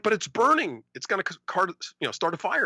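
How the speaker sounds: high-pitched mock fretting; imitated worried friend. Retelling friend arguing over the burning battery, mock worry. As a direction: protest anxiously as the friend, played for laughs.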